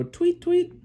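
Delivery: high, piping bird chirp; bird tweets. Acted. Parent showing what the birds go, bird tweets. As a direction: tweet like a little bird, pitch jumping up. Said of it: "The birds go".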